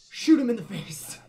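deadpan brute command; voicing Goroth. Gamer voicing Goroth giving blunt advice. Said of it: "Imagine it's Goroth".

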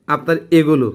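usual speaking voice

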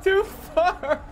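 very high squeal through laughter; laughing protest. Real, not acted. Player scolding fighter for going too far, laughing. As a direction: squeal it out, still cracking up.

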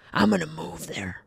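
hushed, breathless aside; whispered threat. Retold or imitated. Dad quietly vowing to move south, whispered. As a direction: whisper it like a secret plan.

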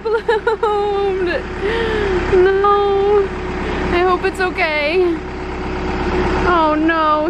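high, drawn-out wail over a small loss; high, plaintive whine; laughing dismay. Real, not acted. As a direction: lament it in a high voice, half laughing. Watching her flowers get ripped up.

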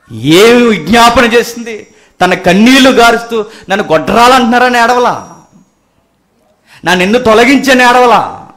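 high, loud impassioned questioning; full-force preaching. Acted. Preacher asking what the weeping mother pleaded, full-force preaching. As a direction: throw the questions out at full volume.

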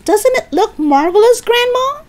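high delighted call, rising at the end; shouting. Acted. George shows Grandma the giant hen, high shout. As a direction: show it off at the top of your register. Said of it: "George shouted".

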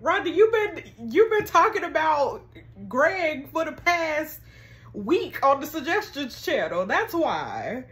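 playfully accusing a friend; animated teasing call-out; excited. Real, not acted. Calling out Rhonda to chat.

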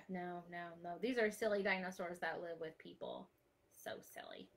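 ordinary delivery

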